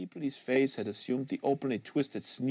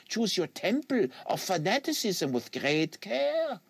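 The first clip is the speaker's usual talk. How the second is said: put-on French Canadian accent; 9 semitones higher than usual. Quebecois agent lecturing the American on fanatic, French Canadian accent.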